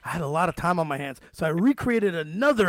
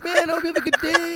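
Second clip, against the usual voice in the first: mock sweetness; 11 semitones higher, 3 dB louder than usual. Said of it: "I'm like".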